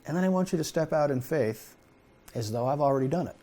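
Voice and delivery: a hint of a tender divine voice; gentle voice of God. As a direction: coax him softly toward imagining. Voicing God gently to you.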